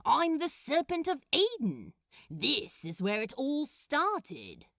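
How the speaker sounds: loud, high proud demon boast; Crowley, proud. Acted. Crowley proudly declaring himself the Serpent of Eden. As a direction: claim Eden as your own.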